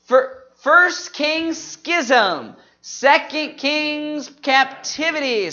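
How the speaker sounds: group recitation. Shown as a demonstration. Teacher leading the congregation in the next books, slow group recitation. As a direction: stretch each book and key word. Leads the memory list.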